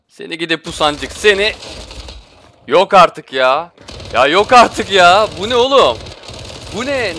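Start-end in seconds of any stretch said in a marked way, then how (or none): 2.7-5.3 slow, louder, stretching out yok artık in disbelief; can't believe it, drag every word; drawn-out disbelief. Real, not acted. Disbelief at enemy tracking. 5.4-7.2 outraged disbelief. Real, not acted. Outraged at the tracking.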